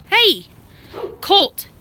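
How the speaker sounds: loud, high call to the dog; sharp shout. Real, not acted. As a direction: snap him to attention with a yell. Dog not listening, calls sharply.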